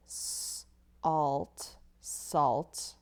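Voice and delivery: "s-, alt" then "salt"; sounded out salt. Shown as a demonstration. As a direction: segment "salt" then blend. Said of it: "I can hear it as I say it".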